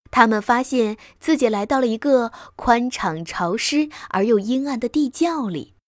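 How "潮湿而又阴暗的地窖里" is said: ordinary delivery, nothing marked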